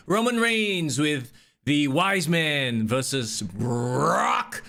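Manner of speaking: drawn-out, slow match introduction; ring announcer. Pretend. Host introducing the main event to his co-host, mock announcer.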